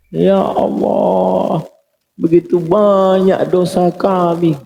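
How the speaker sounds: high, very slow weeping plea; tearful prayer. Retold or imitated. Preacher reenacting his night prayer to the congregation, weeping. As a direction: pull the words out on a breaking cry.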